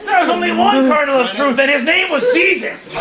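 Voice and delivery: high, mock-outraged zealot; indignant outburst. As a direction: cut in with a hammed-up indignant cry. Pope angrily rebuts Michael in story.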